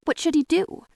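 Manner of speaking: ordinary delivery, nothing marked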